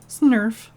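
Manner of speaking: loud sniffly snurf snort; wet snot snort. Acted. Bandit snorts snot back.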